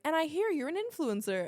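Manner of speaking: quoted neighbor. Retold or imitated. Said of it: "she was like".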